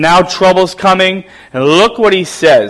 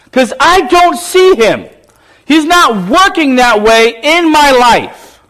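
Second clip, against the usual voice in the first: angry complaint; 7 semitones higher than usual. Acted. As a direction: demand answers from heaven, near tears. Gideon complaining to the angel.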